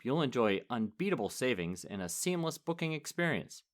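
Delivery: ad read. Real, not acted. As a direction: read the promotional copy smoothly. Reads sponsor ad.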